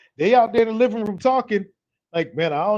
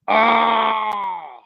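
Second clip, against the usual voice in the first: sustained open holler; drawn-out yell; 3 semitones higher than usual. Real, not acted. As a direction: cry out on one held note. His own drawn-out cry.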